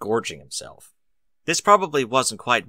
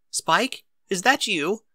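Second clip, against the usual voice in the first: higher, lady's voice calling through the door; genteel female voice, higher; Rarity's voice; 10 semitones higher, slower than usual. Acted. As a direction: voice a refined pony lady, higher.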